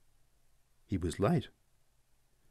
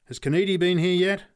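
much higher, louder call upward; shouted up; 9 semitones higher, 6 dB louder than usual. Acted. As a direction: call up to the loft. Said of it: "shouted at one of the men".

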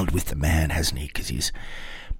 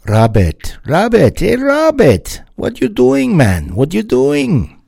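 hammed-up Jamaican accent, much higher and louder, calling out; terrible Jamaican accent; 11 semitones higher, 6 dB louder than usual. DJ jokingly calls to [name] in a terrible Jamaican accent. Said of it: "that's terrible Jamaican accent".

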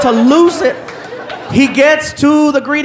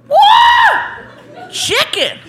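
excited; 7 semitones higher, much slower than usual. Retold or imitated. Man behind a hotel door unpacking his gift basket, excited. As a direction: draw out each item with mounting excitement. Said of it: "getting more and more excited".

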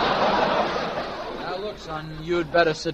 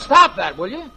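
louder, higher angry bark; angry yell; 7 semitones higher, 6 dB louder than usual. Acted. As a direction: shout him down sharply. Driver yelling at the brat.

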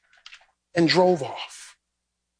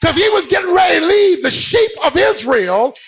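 high, driving sermon delivery; impassioned preacher in full cry; full-force preaching; 11 semitones higher than usual. Real, not acted. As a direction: preach it hard, punching out each phrase.

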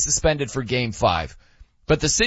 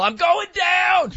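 high, drawn-out mock shout; imitated yell; slower than usual. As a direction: holler it long and high, imitating a falling caller. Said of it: "had to yell".

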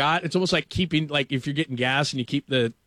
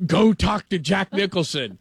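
raised, slowed, insistent command; urgent producer order; 5 semitones higher, 4 dB louder, slower than usual. Retold or imitated. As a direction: imitate a producer yelling in the earpiece. Producer barking order in earpiece.

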